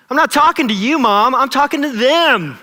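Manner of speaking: high, loud, indignant kid imitation; mock-indignant protest, stretched at the end; exasperated child talking back. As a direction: snap back loudly like an annoyed little kid. Acting exasperated daughter talking back to mom.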